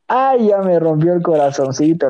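ordinary talk

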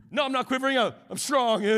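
hammed-up Peter insisting he's strong; mock tough denial. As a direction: play the burly guy pretending not to shake. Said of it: "the quivering lip".

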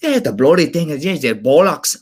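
high, loud mock-Irish rant; Irish accent. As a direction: imitate an angry, hard-to-decipher Irish accent. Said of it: "decipher his Irish accent".

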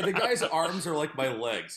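ordinary talk, nothing marked

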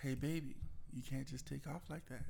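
gentle, softened correction; soft as possible. Retold or imitated. Partner retelling how they corrected the driver, softly. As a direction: say it softly, careful not to set them off. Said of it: "I said it as soft as I could".

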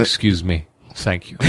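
stagey polite catchphrase; classical stage acting.

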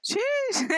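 mock [name] noise. Shown as a demonstration. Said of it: "Is that the [name] noise?".

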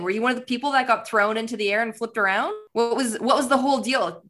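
ordinary delivery

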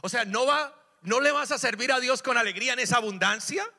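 preacher rebuking a bitter congregation; exasperated preaching. Acted. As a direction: push them with indignation.

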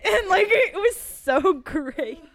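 loud, high words broken by laughter; laughing hard. Real, not acted. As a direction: crack up through the line.

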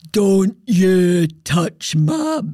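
slow, loud, put-on demonic man's voice; man's voice from inside. Shown as a demonstration. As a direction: drag out each word like a possessed warning. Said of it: "do the voice for you".

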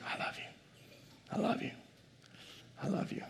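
hushed, repeated tender assurance; whispering. Acted. Preacher as God repeating love to listeners, whispering. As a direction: whisper it softly, pausing between each repeat. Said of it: "I think he's constantly whispering".

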